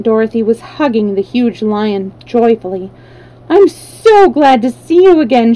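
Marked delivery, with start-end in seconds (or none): 3.5-5.5 high, loud happy exclaim; joyful cry. A narrator's reading. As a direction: burst out with gladness. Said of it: "she cried".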